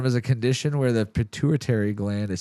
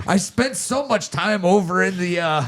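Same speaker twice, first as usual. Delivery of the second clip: higher, hammed-up Irish lilt; Irish accent; 8 semitones higher, 4 dB louder than usual. Joking to friends he came back Irish, mock Irish accent. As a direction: ham up a stage Irishman. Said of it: "I just talk like this".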